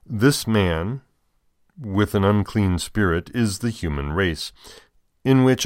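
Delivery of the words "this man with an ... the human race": normal talking voice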